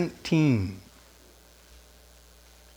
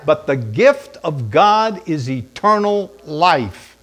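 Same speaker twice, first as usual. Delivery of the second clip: normal talking voice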